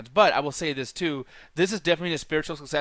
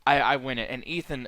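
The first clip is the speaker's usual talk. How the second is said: ordinary talk, nothing marked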